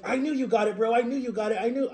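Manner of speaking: acting out a friend's delighted encouragement; happy for a friend. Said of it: "He was, like, so happy for me".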